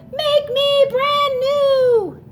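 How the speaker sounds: high, slow, strange little voice from a puddle; a melted snowman pleading from the ground. Acted. Melted snowman asks children to rebuild him, high slow odd voice. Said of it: "A voice from the puddle said".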